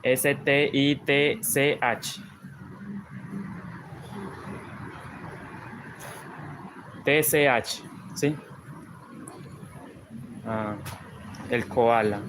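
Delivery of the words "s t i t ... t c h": S T I T C H, each letter said slowly and separately; spelling out letters. Shown as a demonstration. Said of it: "No sé si lo pronuncié bien".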